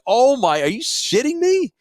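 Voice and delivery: can't believe what he's hearing; outraged disbelief. Retold or imitated.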